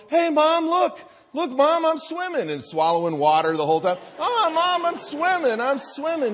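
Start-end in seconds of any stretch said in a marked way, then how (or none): 0.1-2.5 put-on much higher, excited little-boy call across the pool. Excited toddler calling from pool. 4.2-6.3 put-on much higher, excited little-boy call across the pool. Excited toddler calling from pool.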